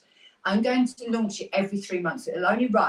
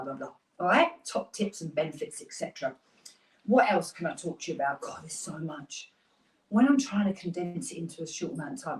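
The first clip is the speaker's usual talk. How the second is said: ordinary talk, nothing marked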